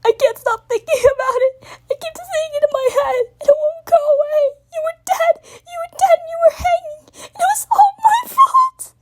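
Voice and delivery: high, loud breaking sobs building; sobbing confession. Acted. Spike sobbing out his guilt to Twilight. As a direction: sob harder with each line.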